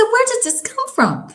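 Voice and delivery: high, loud shocked question; retold shock. On the scale, gasping at her own weight gain, shocked and high. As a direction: blurt it out in disbelief. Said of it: "I said".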